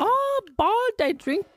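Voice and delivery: high, slow mock Scottish lilt; Scottish accent.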